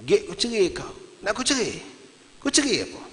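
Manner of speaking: "cerai" pitched high, climbing with each take; high, challenging question with the peak on "cerai"; questioning intonation. Shown as a demonstration. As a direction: jump up on "cerai", then let it fall. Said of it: "intonasinya pertanyaan".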